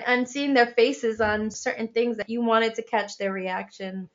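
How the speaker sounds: ordinary talk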